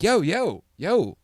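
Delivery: calling after the man trying to leave. Retold or imitated.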